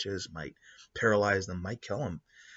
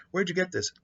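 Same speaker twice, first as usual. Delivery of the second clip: higher, eager asking voice; eager genius; 10 semitones higher, 3 dB louder than usual. Retold or imitated. Genius examining tech, eager and higher. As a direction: beg a little, wide-eyed.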